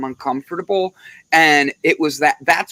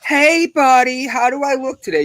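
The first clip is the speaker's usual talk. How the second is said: mock fishing for compliments; 9 semitones higher than usual. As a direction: pitch up and bat the eyelashes. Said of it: "Like, eh".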